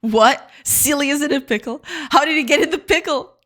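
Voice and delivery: high, loud dramatic demand; hammed-up shock, pitched high; mock outrage. Mock outrage about pickle.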